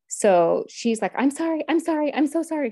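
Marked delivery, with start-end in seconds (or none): 1.1-2.7 put-on high, rushed little-girl plea; mildly tearful retold apology, pitched up; imitating crying child. Little daughter begging as visit ends early, imitated crying. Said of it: "she's like, she's still crying, she's still screaming".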